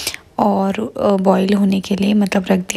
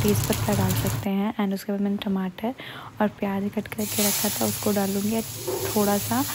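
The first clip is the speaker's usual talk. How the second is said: much softer, hushed cooking talk; speaking softly; 9 dB quieter than usual. Real, not acted. Mom talks softly to viewers beside her sleeping daughter, hushed. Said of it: "मैं इतना धीरे धीरे इसलिए बोल रही हूँ".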